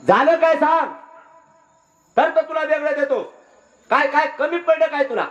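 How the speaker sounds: high, insistent outcry; indignant demand. Acted. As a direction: challenge your brother in hurt outrage. Elder brother demands an explanation.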